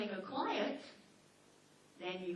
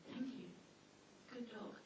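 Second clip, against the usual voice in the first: sincere thanks; 12 dB quieter than usual. Shown as a demonstration. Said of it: "Mean it".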